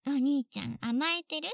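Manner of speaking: usual speaking voice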